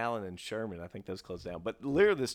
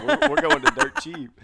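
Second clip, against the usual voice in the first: excited mom; 13 dB louder than usual. Retold or imitated. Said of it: "she was like".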